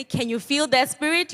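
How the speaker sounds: high rising call; rallying call. Shown as a demonstration. Speaker demonstrating the call to students, high and rising. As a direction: lift the crowd with it. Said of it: "When I say".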